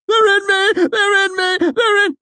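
very high, loud, frantic shrieking; panicked screaming. Acted. A restrained asylum patient screams in panic. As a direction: scream it over and over in terror. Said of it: "The screaming continued in an unbroken chant".

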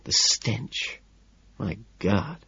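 hushed, breath-only voice; soothing whisper. A narrator's reading. Narrator strokes the man's face, whispering. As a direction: whisper it softly, as if comforting. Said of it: "I whisper this soothingly".

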